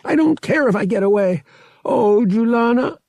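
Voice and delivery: cling to her as she dies; anguished grief. Acted. Han holds dying friend.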